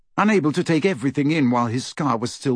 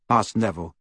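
ordinary delivery

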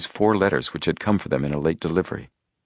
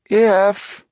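high, loud interjection; cutting in; 6 dB louder than usual. A narrator's reading. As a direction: cut in sharply on one high, loud word. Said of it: "Chet cut in".

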